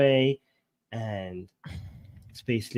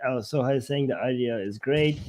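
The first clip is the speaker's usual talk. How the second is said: ordinary talk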